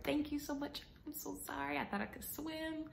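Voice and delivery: high, soft little apology; retold apology. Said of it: "I apologize to him".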